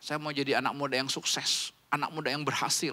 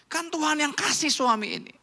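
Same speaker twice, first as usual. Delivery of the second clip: usual speaking voice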